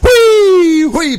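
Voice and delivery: very high, loud, drawn-out squeal; joyful whee. A narrator's reading. Children zooming round steel pillars, joyful squealing. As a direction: shriek "whee" like a child speeding along. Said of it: "Freude machte es".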